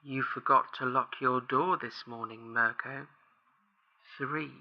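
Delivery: low, soft menacing caller; quiet creepy threat, fading away; eerie monotone. A narrator's reading. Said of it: "spoke in an odd monotone".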